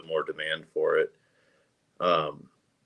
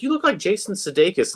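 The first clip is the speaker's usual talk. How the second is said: high mocking girl voice; 11 semitones higher than usual. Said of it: "she's like".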